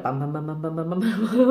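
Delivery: rising run of mock bangs; rapid-fire bams. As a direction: fire off bams like videos piling up. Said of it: "it's suddenly gonna be like".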